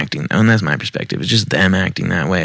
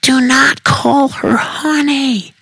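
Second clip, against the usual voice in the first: very high, slow, drawn-out shriek; near scream; slower than usual. A narrator's reading. Jealous woman to the man, practically screaming. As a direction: screech each word out slowly. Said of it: "practically screams".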